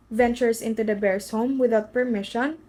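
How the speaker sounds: plain reading voice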